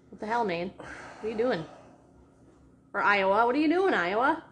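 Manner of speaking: loud, high mock indignation; rising, exasperated challenge, played up; mock scolding. Scolding Iowa for its bad weather, loud mock outrage.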